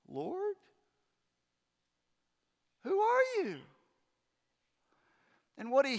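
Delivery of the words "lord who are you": much higher, astonished question; Paul lying in the road, asking the voice much higher in amazement; stunned Paul. Acted. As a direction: ask in awe and disbelief.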